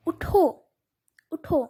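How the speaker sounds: اٹھو said firmly, then a full stop; firm command اٹھو, cut off by a pause; stop after اٹھو. Shown as a demonstration. Teacher showing where to stop, firm اٹھو with pause. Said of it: "میں نے یہاں stop لے لی".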